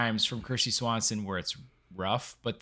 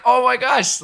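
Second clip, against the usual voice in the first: excited; 12 semitones higher, 8 dB louder than usual. Retold or imitated. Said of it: "I'm like".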